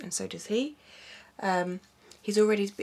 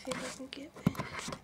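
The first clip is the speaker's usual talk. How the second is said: whispering; 5 semitones higher, 6 dB quieter than usual. Real, not acted. Filming near sleeping baby.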